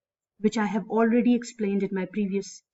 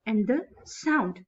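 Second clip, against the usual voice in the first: slow "And the", pause, weight on SOUND; stress on SOUND. Shown as a demonstration. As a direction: anapaest reading, landing on SOUND. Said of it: "the main word is here on third number".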